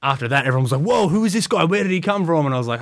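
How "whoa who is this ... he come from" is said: higher, surprised mock exclaim; retold coaches amazed by a standout; impressed surprise. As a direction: sound impressed by the new guy. Coaches impressed after the promos.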